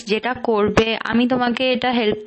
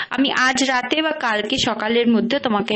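ordinary talk, nothing marked

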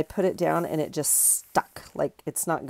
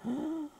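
surprised gasp; 11 semitones higher, 9 dB quieter than usual. Real, not acted. Finds the piece fits.

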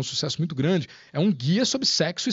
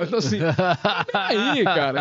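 rindo; 3 dB louder than usual. Real, not acted. Ri da própria história.

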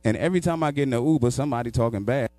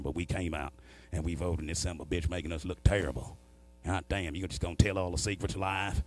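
lower, softer mock hillbilly; 5 semitones lower, 7 dB quieter than usual. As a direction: grumble your gripes like a West Virginia hillbilly. Redneck driver rant.

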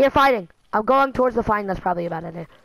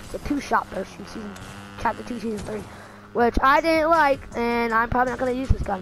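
usual speaking voice

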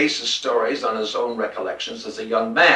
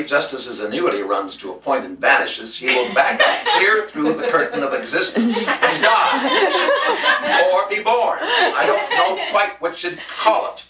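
laughing through words; 5 semitones higher than usual. Real, not acted. Reading while laughing.

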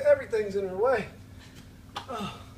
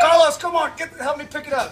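loud shout to a coworker; 6 semitones higher, 9 dB louder than usual. Real, not acted. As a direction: yell his name across the room. Calling coworker during heavy lift.

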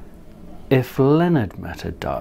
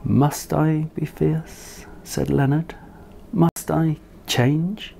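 timid questioning; 5 semitones higher than usual. Acted. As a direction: ask it small and doubtful. Leonard unsure before the lions.

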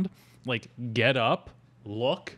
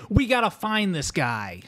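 lightly voiced nasal Travolta impression; a hint of nasal southern twang; nasally Travolta; 9 semitones higher than usual. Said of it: "like, a nasally voice".

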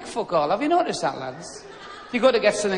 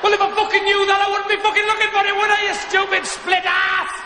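high, loud ranting retort; furious comic rant, held high all through; exasperated angry shout; 10 semitones higher, 3 dB louder than usual. Acted. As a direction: blow up at a daft question. Acted row over lost wallet.

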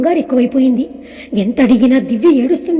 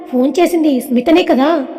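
usual speaking voice

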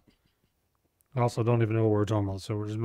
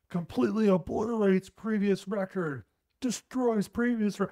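higher, overblown news-headline read; mock headline voice; 9 semitones higher than usual. Mocking the sports headlines, read as an overblown announcement. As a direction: ham up the breathless headline. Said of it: "the headlines are".

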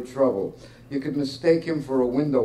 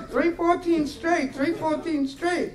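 squawking parrot, much higher and slow; slower than usual. Retold or imitated. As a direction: mimic a parrot repeating the bets. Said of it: "this parrot screaming the numbers".

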